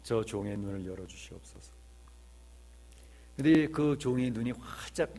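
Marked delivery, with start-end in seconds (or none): 0.0-1.7 low, soft prayer; prophet's prayer. Retold or imitated. Prophet Elisha prays for his servant's eyes, hushed and low. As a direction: pray quietly on another's behalf. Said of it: "엘리사 선지자가 기도를 합니다".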